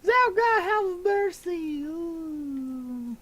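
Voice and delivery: very high, loud wailing plea; crying plea. Acted. Kobold wails for mercy from Zelda, very high loud cry. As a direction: wail for mercy.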